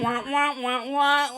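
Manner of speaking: wah-wah mimicry of a voice; teasing nonsense babble; mocking imitation. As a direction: parody how someone hears you talk. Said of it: "Is that what the fuck I sound like".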